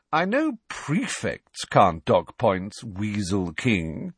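slow, drawn-out sneer; slow contemptuous sneer. Acted. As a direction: sneer down at him slowly. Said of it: "sneered Malfoy".

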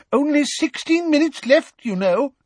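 high-pitched, fretful reminder; anxious. A narrator's reading. Professor reminding distracted students of time, anxious. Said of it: "said Professor Tofty anxiously".

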